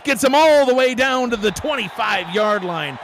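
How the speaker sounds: high, loud breakaway-run call; thrilled sportscaster in full cry; excited play-by-play. Real, not acted. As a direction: shout the run as it unfolds, then ease slightly.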